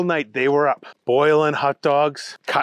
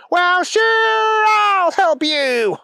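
very high, loud cartoon buddy voice, drawn out slowly; high goofy friend agreeing to help; Keith's goofy voice; 5 dB louder, much slower than usual. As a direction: squeal it like an eager cartoon sidekick. Keith figure in story.

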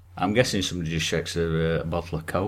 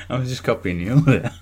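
ordinary talk

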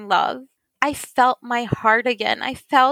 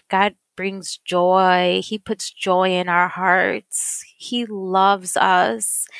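slow, heartfelt; 3 semitones lower, much slower than usual. Real, not acted. Testimony slowing down.

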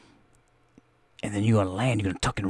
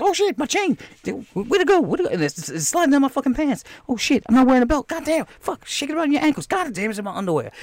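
high-pitched comic fluster; panic mode; 13 semitones higher than usual. Acted. Fighter losing his popped chain, high mock panic. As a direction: act out a squeaky, frantic search. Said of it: "they go into panic mode".

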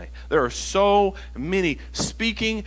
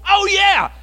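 high, loud burst of recognition; excited realization; 13 semitones higher, 6 dB louder than usual. Acted. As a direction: exclaim it as a sudden discovery. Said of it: "and then go".

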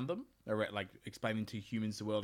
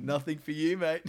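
higher, louder smug bro voice; a smug trickster gloating to his mates; gloating titan; 6 semitones higher, 7 dB louder than usual. Retold or imitated. Titan gloating in retold myth.

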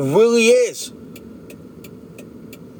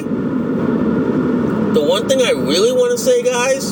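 slower, drawn-out emphasis on thing; slow emphatic restart; 5 semitones higher, slower than usual. Shown as a demonstration. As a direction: lean in and stretch it out. Vlogger restarts his opening line.

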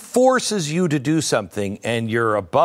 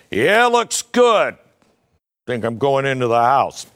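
pretend rancher; 4 dB louder than usual. Idle rancher surveying his land in a hat, mock hearty call.

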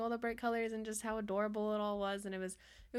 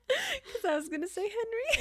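laughing; 6 dB louder than usual. Real, not acted. Laughing answer.

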